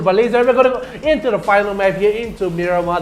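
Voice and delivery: big booming announcer call, sped up; hype announcer. Real, not acted. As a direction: announce it like the main event. Caster announces the final map.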